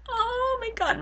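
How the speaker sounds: high, drawn-out fangirl coo; gushing coo. Acted. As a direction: melt over her in a high voice. Gushing over sweet fictional mom.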